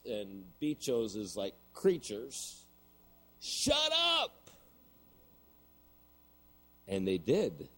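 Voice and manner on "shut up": very high retold yell; screamed command. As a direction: imitate a friend screaming at barking dogs. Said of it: "screams at the top of his voice".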